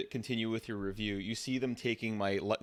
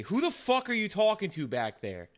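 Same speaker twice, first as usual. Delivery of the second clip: high, incredulous demand from a cop; angry police officer; 12 semitones higher, 4 dB louder than usual. Acted. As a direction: cut in, annoyed, toward the back seat. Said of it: "the officer interrupts you and say".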